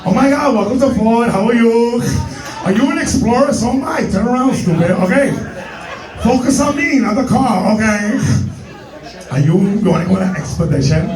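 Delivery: hammed-up camp salesman greeting. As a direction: play it camp and sassy.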